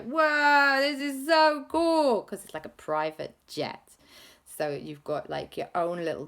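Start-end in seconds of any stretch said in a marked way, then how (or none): 0.0-2.2 amazed whoa. Acted. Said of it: "everyone was like".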